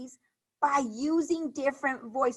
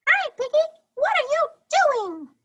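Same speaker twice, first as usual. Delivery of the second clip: very high, loud cartoon voice; squeaky character voice; 6 dB louder than usual. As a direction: squeak it out like a little cartoon animal. Teacher voices a book character.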